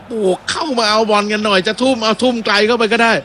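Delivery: voicing a player calling out. Commentator voices Abdulhamid calling teammates in for the throw, mock shout. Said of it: "อับดุลฮามิดบอก".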